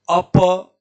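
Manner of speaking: अप with the final प sounded in full, loud; full final vowel in अप. Shown as a demonstration. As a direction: say अप as a-pa, the last vowel open. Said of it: "देखो मैं बोल रहा हूँ अप". (अप के प का पूरा उच्चारण)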